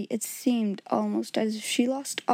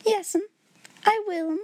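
trembling reply; 9 semitones higher than usual. A narrator's reading.

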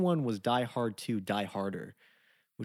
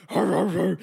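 dog growls; 8 semitones higher, 10 dB louder than usual. Retold or imitated. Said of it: "the dogs were like".

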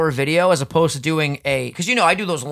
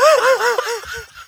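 howling with laughter. Real, not acted. Reacting to his own joke.

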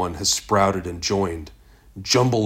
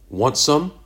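bright high offer; cheerful offer; 7 semitones higher, 5 dB louder than usual. A narrator's reading. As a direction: toss it out, friendly.

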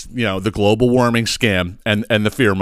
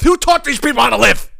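high-pitched outburst of disbelief; exasperated yelling; 13 semitones higher, 3 dB louder than usual. Real, not acted. Host fed up with yelling neighbors, exasperated high outburst. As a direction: rant in a high, strained yell.

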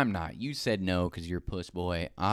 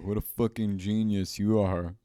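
sarcastic. Real, not acted. Sarcastic slow praise.

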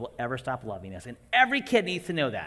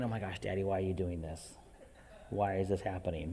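put-on softer, lower, stunned children's voice; softer and lower, with a small laugh, mock dismay; dismayed kids; 7 semitones lower, 7 dB quieter than usual. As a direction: voice the shocked siblings at the table. Voicing staring kids.

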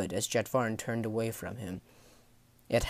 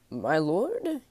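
higher-pitched, hesitant sailor voice; startled sailor; 6 semitones higher than usual. Acted. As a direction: start a timid, taken-aback question.

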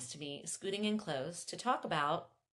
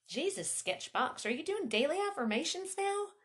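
amused teasing; 10 semitones higher, 4 dB louder than usual. A narrator's reading. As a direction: tease your friend, pitch climbing high.

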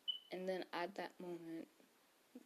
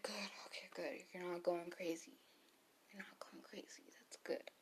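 mock relieved whisper; 7 dB quieter than usual. Retelling his relieved reply.

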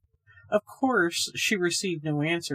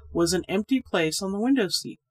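plain reading voice

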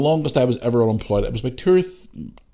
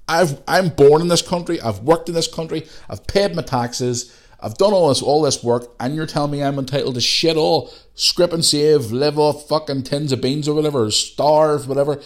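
raised pitch, aggrieved protest; indignant rant; 6 semitones higher than usual. Retold or imitated. Taxpayer protesting at being refused benefits, indignant. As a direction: pile up the grievances in outraged disbelief.

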